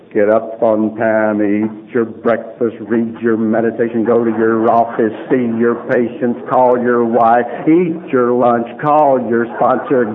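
rote list. Retold or imitated. Reciting rigid daily routine.

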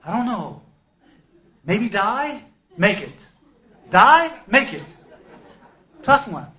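loud, high comic dithering between two options; hammed-up indecision in short, punched bursts; mock deliberation. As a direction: weigh them out loud like a ridiculous dilemma. Mock weighing of die or survive.

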